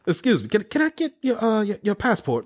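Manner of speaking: a French airport officer asking for the passport in a mock French accent. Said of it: "If it's not a good French accent".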